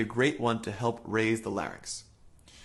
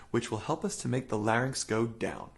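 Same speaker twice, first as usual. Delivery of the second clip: normal talking voice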